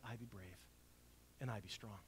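quiet. Acted. Repeated quietly as congregation mantra.

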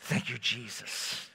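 hushed, slow, sing-song prayer whisper; mock church voice. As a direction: parody a pious churchgoer reading the psalm. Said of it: "sing-songy voice ... prayer whisper".

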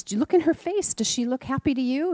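put-on high, bright questions to a small child; coaxing teacher. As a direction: lead the child gently to see it.

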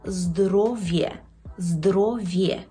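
здоров'є slowly as zdro-vye; clear ye. Shown as a demonstration. Teacher drills final letters, ye in здоров'є. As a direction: draw out здоров'є, ye at the end. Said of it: "читається як Є".

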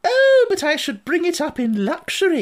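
gushing grand lady. A narrator's reading. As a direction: coo over the baby like a grand lady. Lady character speaks.

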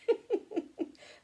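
high, unvoiced little chuckle; gentle chuckle. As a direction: chuckle to himself, unafraid. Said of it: "He started to chuckle".

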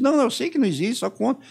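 high, loud imitated interrogator insisting; insistent shout. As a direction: push him hard to just tell it. (grito insistente imitando o interrogador)

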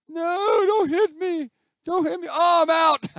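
very high, loud squeal; playful high-pitched panic, shouted; panicked pleading. Pretend. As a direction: squeal for mercy as the ball comes at you. Pleads as ball comes at him.

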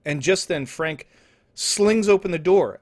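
ordinary talk

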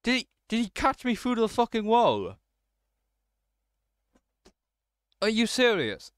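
high, loud outraged shouting; outraged disbelief. Real, not acted. Player caught by Slender Man through a wall, outraged shout. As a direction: yell in real disbelief at the unfair catch.

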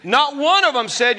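usual speaking voice

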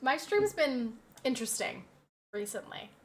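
usual speaking voice

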